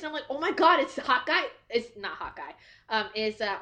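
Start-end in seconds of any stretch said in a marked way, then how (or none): 0.3-1.4 loud, high fangirl exclamation; excited shock. Retold or imitated. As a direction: burst out in amazed recognition. Viewer re-enacts shocked reaction.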